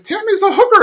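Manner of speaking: high-pitched mock outburst; blurted out. [name] announcing it to the whole library, high blurt.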